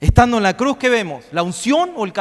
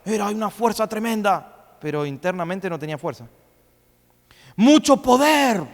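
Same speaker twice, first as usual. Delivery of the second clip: ordinary delivery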